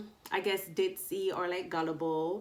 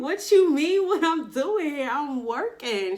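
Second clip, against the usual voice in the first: high, loud mock indignation; retold loud comeback; indignant retort; 7 semitones higher, 8 dB louder than usual. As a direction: fire back in disbelief. Her own retold reply.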